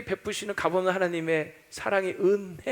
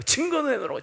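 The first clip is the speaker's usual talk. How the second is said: demanding proof; 8 semitones higher than usual. Retold or imitated. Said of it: "뭘 사랑하냐고".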